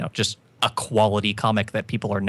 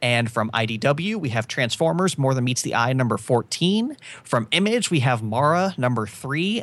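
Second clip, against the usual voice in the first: upbeat, higher release-list announcer; announcer read; 4 semitones higher than usual. Acted. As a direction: read the list like a radio host.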